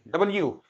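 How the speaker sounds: डब्ल्यू said as double-YOU, falling; correct letter name. Shown as a demonstration. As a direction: restate डब्ल्यू with a clear 'yoo'. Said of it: "इसको डब्ल्यू बोलते हैं". (डब्ल्यू, 'डबल-यू' जैसा उच्चारण)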